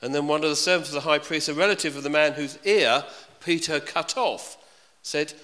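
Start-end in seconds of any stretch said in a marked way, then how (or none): none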